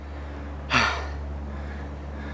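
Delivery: long soft exhale of effort; tired sigh. Real, not acted. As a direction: sigh out the strain of the climb. Tired on steep climb.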